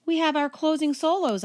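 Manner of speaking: put-on high, pleading girl's voice; lightly voiced pleading girl. A narrator's reading. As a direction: beg her not to cancel. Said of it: "I pleaded".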